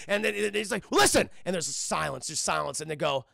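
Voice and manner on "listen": high, loud cry; acted desperate outburst; frantic demand. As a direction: shout it urgently. Frantic patient demands they listen.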